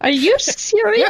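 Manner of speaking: very high, laughing echo of disbelief; mock a disbelieving question way up high; high-pitched, amused imitation of outrage; mimicked incredulity. Listener mimics the drone's line.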